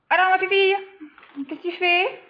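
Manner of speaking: high, loud singsong to the pet; pet talk. Real, not acted. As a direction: coo loudly at your little darling. Talking sweetly to her pet.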